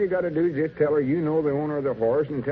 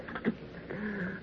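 pleased laugh; 8 semitones higher, 12 dB quieter than usual. Acted. Laugh after hanging up.

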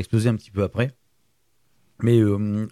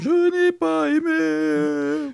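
squeaky voiced valve; much slower than usual. Voicing the valve, joke.

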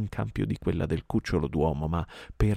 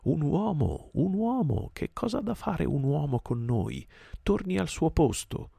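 high-pitched howled cries; howling pack; 11 semitones higher than usual. A narrator's reading. Wolf pack rejecting the man-cub, howling. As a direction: wail it high like a jeering pack. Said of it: "più della metà del branco urlò".

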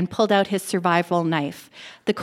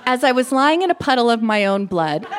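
ordinary delivery, nothing marked

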